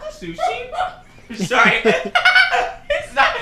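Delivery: laughing hard, high pitched; giggling through the words. Real, not acted. Laughing fit over warm sushi.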